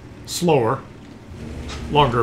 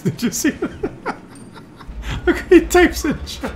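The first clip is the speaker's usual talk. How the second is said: high-pitched, helpless real laughter through the words; laughing with him; 12 semitones higher than usual. Real, not acted. Commentator watching the player type in chat, laughing with him. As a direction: squeal it out while laughing. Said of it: "I'm laughing with you, my friend".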